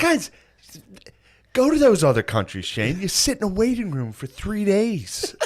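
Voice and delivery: lightly voiced lecturing father; imitating dad. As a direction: play the preachy dad. Dad lecturing about healthcare.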